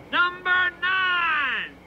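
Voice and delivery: long, drawn-out high call; yelled out. Acted. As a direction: holler it slowly down the cell block. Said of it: "he yells out".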